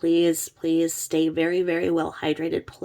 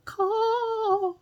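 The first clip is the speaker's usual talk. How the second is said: crow caw. Shown as a demonstration. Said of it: "sing like a bird, like, go".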